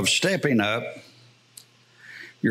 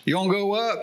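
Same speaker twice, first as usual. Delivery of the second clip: higher, inviting call up to the front; imitating Bob's call; 7 semitones higher, faster than usual. Pastor mimics Bob beckoning the congregation forward, higher inviting call. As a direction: echo the preacher's beckoning question. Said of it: "You remember that?".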